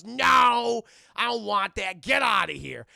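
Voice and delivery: loud shouted rejection; acted furious yell; angry refusal. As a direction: shout them away in a hammed-up rage. Demona angrily refusing Puck.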